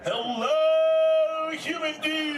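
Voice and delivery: high, drawn-out surfer holler; stoked, stretched-out turtle shout; big crowd greeting. Acted. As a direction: holler hello to a whole crowd. Calling out to the crowd.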